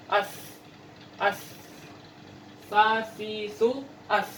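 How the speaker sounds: "As, as, sa, si, su, as" with tongue tip behind the teeth; crisp s sounds, tongue touching inner teeth; Arabic sin pronunciation drill; Arabic letter sin drill. Shown as a demonstration. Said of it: "ujung lidah bertemu dengan bagian dalam gigi".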